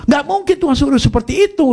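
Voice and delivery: high-pitched mock outrage from a sister; imitated angry scolding. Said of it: "Nah, dimaki-maki saya sama cici saya".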